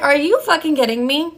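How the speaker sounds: indignant disbelief, acting out a retort; incredulous outrage. Retold outraged reply to boss.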